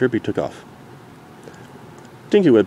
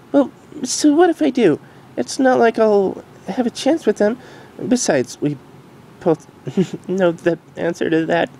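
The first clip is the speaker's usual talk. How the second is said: higher, gentle mare voice; reassuring Derpy; 11 semitones higher than usual. Acted. Derpy hugging Dinky, gently reassuring.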